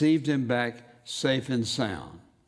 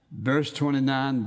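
ordinary talk, nothing marked

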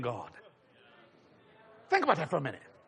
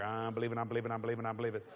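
rapid auctioneer chant, repeating; 8 semitones lower, 8 dB quieter, much faster than usual. Retold or imitated. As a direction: rattle it off like an auctioneer. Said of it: "saying it like an auctioneer".

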